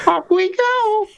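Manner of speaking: drawn-out cartoon mouse send-off; lightly voiced mouse, slow and jolly; Mickey Mouse. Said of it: "take a good stab at Mickey Mouse".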